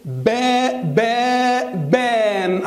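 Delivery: با held as a long "beh", then closed into بان; sounding out. Shown as a demonstration. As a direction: build بان slowly from a repeated, stretched با. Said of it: "تبقى إيه؟".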